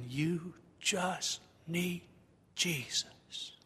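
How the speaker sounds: very slow, soft, word by word; hushed, deliberate. Acted. Preacher landing his story's lesson on the congregation, hushed and deliberate. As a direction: let it sink to a near-whisper on the last word.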